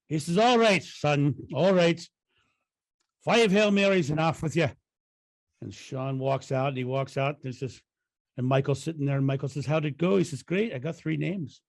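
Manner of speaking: lightly put-on Irish accent for the punchline; mild Irish lilt, slowing into the payoff. Delivering the joke's punchline to a friend, light Irish accent. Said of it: "play with my Irish accent".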